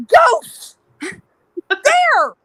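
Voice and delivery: very high mock-terrified cry; panicked squeal. Pointing back toward the bar for dad, high acted terror. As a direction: squeal single words in breathless alarm.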